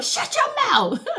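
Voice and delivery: high, sing-song fake astonishment; mock shock. Repeating her favourite fake-surprise line, high and hammed. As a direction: ham up the disbelief. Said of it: "That's my favorite one".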